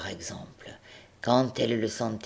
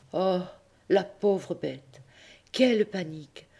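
mock pity; 6 semitones higher than usual. A narrator's reading. Narrator pities the mule.

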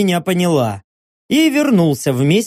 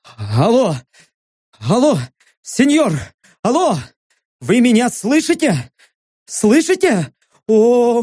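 frantic, raised, trembling gabble; too much caffeine; 7 semitones higher, slower than usual. Acted. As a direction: gabble in a high shaky panic. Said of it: "повысив голос, добавив дрожание, торопливо затараторил".

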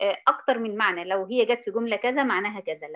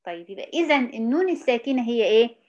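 ordinary talk

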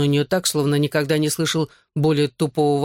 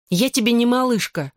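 mildly huffy, pitched up; understated grumble, higher than usual; grumpy retort; 6 semitones higher than usual. A narrator's reading. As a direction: snub the pet name with annoyance. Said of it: "буркнула Клэр".

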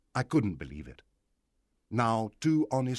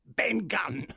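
a lightly voiced odd castaway, pitched up; strange voice; 9 semitones higher than usual. Marooned Ben Gunn answers Jim in a strange, higher voice. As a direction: say your name like a man long alone. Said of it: "he answered in a strange voice".

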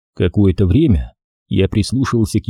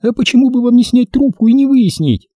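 high-pitched female character voice; high, reasonable-sounding lady; logically advising woman; 14 semitones higher, 3 dB louder than usual. Acted. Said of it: "совершенно логично посоветовала она".

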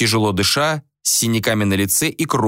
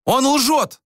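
high-pitched, accusing yell; acted woman's indignant shriek; outraged scream. A narrator's reading. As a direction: yell over his explanation. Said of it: "завопила Ванора".

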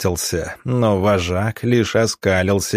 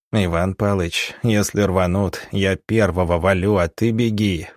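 ordinary delivery, nothing marked

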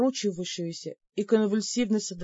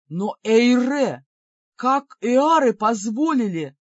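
slow, loud demon cry; stunned demon; 3 semitones higher, 5 dB louder, slower than usual. Acted. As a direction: cry it out in dismay.

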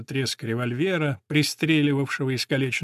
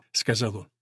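ordinary delivery

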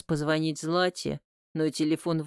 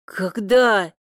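hoarse; 3 semitones higher, 7 dB louder than usual. A narrator's reading. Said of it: "хриплю".

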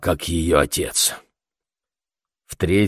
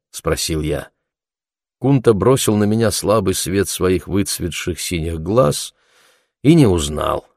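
ordinary delivery

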